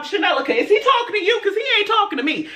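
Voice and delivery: high, fast, sassy disbelief; retold quick-fire attitude; indignant. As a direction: check with a friend in rapid mock outrage. Indignant aside to friend, retold.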